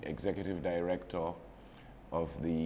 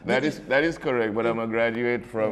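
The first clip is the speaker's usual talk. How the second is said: laughing; 5 semitones higher, 11 dB louder than usual. Real, not acted. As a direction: still laughing, higher and louder. Talks through his laugh.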